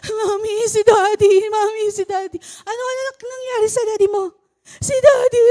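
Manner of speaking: put-on high, loud panicked child calling for mami; high, loud, frantic child's cry. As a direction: imitate a frightened little girl on the phone, voice rising. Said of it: "ang ate A A ito umiiyak".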